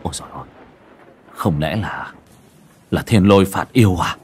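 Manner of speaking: hushed gasp building into alarm; startled cry. A narrator's reading. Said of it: "rồi cậu giật mình kêu lên". (giật mình thốt lên, từ khẽ đến to dần)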